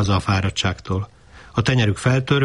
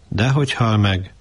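ordinary delivery

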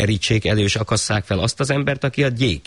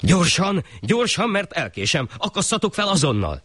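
high urgent calls; the thief pressing the executioners; eager high cry; hurried thief; 12 semitones higher than usual. Acted. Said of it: "kiáltotta hirtelen a tolvaj".

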